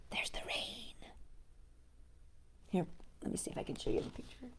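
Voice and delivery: soft unvoiced whisper; a quick, soft whisper to the listener; whispered aside. Real, not acted. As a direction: hush as you show the page. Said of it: "aside to viewer".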